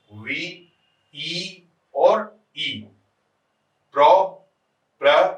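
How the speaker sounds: each syllable set apart with pauses. Shown as a demonstration. Teacher helping students count a line's syllables, syllable by syllable. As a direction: count it out slowly, piece by piece. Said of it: "इसमें आप गिन लेंगे".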